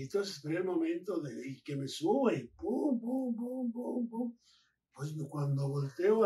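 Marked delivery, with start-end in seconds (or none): 2.5-4.4 higher-pitched rapid "pum" blows; imitated punching thuds. Wrestler telling how he climbed in and hit, imitated thuds. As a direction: voice a string of hits landing.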